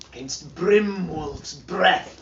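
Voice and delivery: ordinary delivery, nothing marked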